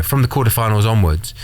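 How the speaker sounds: ordinary talk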